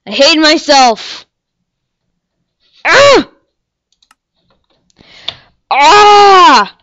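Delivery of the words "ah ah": a loud, high scream of frustration; frustrated scream. Real, not acted. Frustration after death.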